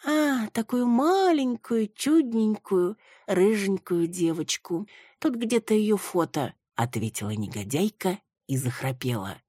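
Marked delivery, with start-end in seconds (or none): 0.1-6.5 dreamy. A narrator's reading. Drunk dreamy description.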